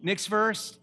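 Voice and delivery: usual speaking voice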